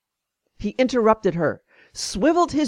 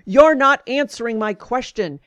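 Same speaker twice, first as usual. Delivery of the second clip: mild retold yell, higher pitch; half-raised angry voice; mock yell; 4 semitones higher, 4 dB louder than usual. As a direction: imitate an angry doctor yelling, restrained. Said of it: "He turned red and yelled at me".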